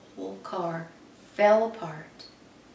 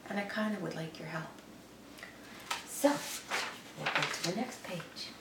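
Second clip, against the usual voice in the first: ordinary delivery, nothing marked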